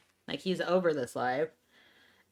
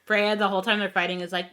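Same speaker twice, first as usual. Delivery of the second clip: ordinary delivery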